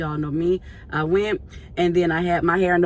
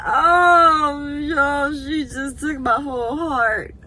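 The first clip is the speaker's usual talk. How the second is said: overcome cry; 8 semitones higher, 3 dB louder, much slower than usual. Pretend.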